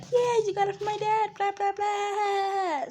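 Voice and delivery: high, drawn-out mocking retort; sarcastic. Kid mocking mom over dad, sarcastic. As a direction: whine it in a high singsong, dragging the blahs. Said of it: "I had to act sarcastic".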